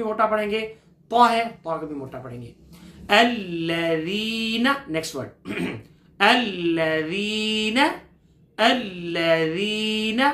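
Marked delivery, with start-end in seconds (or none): 3.1-4.7 drawn-out recitation with a doubled lam; slow tajweed recitation; slow Quran recitation. Shown as a demonstration. Teacher recites word. 6.2-10.2 الذین with a held, doubled lam; الذین recited slowly with a firm doubled lam. Shown as a demonstration. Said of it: "لام پر تھوڑا سا رک کر سختی لائیں گے".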